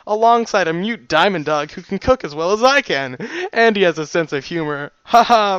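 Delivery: put-on big, loud, hearty cook with an odd accent; boisterous, back-slapping pony chum; jolly stallion. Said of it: "His odd accent".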